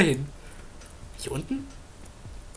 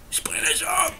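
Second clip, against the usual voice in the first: whispered mock threat; 11 semitones higher than usual.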